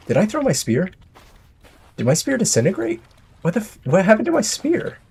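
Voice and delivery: bewildered about the missing spear; baffled. Real, not acted. Spear vanished in game.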